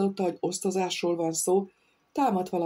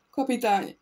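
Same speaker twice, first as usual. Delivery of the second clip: könyörögve; 7 semitones higher than usual. A narrator's reading. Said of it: "mondta könyörögve".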